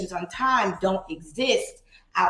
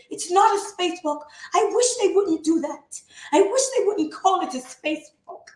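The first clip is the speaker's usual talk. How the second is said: put-on the sister's accent, pitched higher all through; Lerato's accent; 9 semitones higher than usual. Redoing her sister Lerato's complaint in her sister's accent, higher. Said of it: "this is my, my accent of you".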